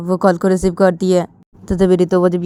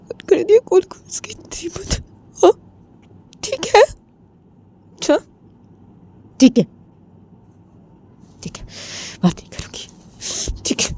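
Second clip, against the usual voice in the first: high voice breaking into breath; acted sobbing, voice giving out; tearful indignation; 9 semitones higher than usual. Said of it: "Ritu वो रो रही थी".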